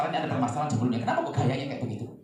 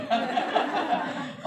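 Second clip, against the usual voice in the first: laugh; 10 semitones higher than usual. Real, not acted.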